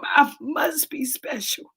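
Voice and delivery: soft slow whisper to herself. Real, not acted. Whispering to herself that she must be special. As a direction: breathe it out like a private truth. Quiet self-affirmation mid-testimony.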